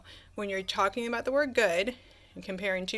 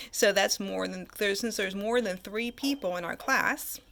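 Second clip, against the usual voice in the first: normal talking voice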